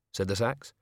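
ordinary delivery

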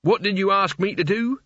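high, icy threatening question; menacing anger. A narrator's reading. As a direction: dare it to repeat itself. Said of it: "His voice held enough heat to melt iron".

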